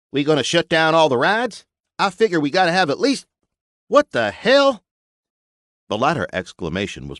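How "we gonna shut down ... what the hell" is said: folksy builder. Acted. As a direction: talk like a blunt country mechanic. Buster interrupted by explosion.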